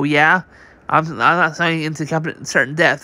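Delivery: usual speaking voice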